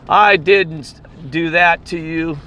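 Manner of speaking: high, slow, drawn-out mock protest; retold confrontation. Drinker getting up at the bouncer, retold confrontation. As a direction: imitate a man squaring up, stretching each word. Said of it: "he gets up and he goes".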